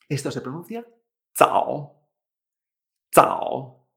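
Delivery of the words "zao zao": zao, unaspirated ts and Chinese third tone. Shown as a demonstration. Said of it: "como una T S, pero con una vibración".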